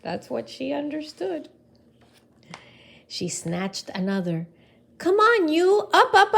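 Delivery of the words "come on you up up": high, loud urging; yelling. Acted. Camilla shouts at another leaf, loud and high.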